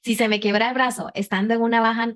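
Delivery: ordinary delivery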